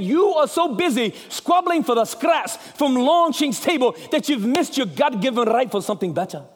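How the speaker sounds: amateur movie-hero speech in Scots; Scottish accent. Acted. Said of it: "It's not bad for a redneck".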